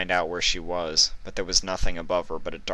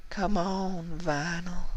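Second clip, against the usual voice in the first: high, softer, faraway call; distant voice; 12 semitones higher, 4 dB quieter, slower than usual. Acted. Ruby calls Vinyl from afar, soft and distant. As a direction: sound as if from far off. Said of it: "her voice sounding quite distant".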